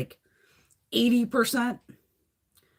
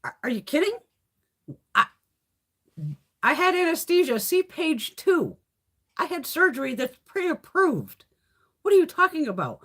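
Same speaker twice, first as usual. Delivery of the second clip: indignant retort; 8 semitones higher than usual. Retold or imitated. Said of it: "I'm like".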